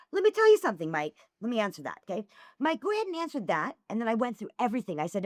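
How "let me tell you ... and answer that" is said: retold mad rant. Woman telling off a messaging fan, retold as mad. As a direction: lay into him, pitch jumping up on the openings. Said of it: "I was so mad".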